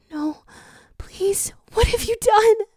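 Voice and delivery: high breathy whisper rising in horror; horrified whisper. A narrator's reading. Tikal after hearing the jackal fall, horrified whisper. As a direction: breathe it out in shock. Princess after falling scream.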